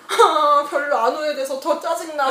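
high, loud tearful whine, hammed up; mock weepy exasperation; retold sniffling annoyance. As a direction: re-enact a sulky post-cry grumble. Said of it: "훌쩍훌쩍 하면서".

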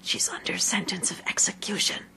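secretive full whisper; hushed, voiceless confidence. A narrator's reading. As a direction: breathe the secret close to her ear. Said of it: "and whispered".

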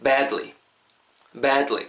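"badly" with a long open "a"; lengthened vowel. Shown as a demonstration. Teacher models for learners, "badly" with a lengthened "a". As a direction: hold the first vowel of "badly". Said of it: "요거 발음하실 때 좀 길게".

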